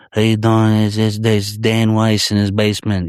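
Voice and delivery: mobster-style Italian-American greeting; Italian-American accent. Retold or imitated. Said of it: "what accent?".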